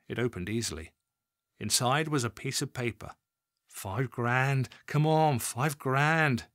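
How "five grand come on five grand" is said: ordinary delivery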